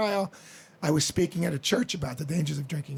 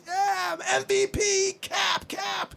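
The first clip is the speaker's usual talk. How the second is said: much higher, chanting cheer; imitating a crowd cheering; 13 semitones higher, much slower than usual. Mimicking fans roaring for Mattingly, high excited chant. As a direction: cheer like the whole stadium going nuts.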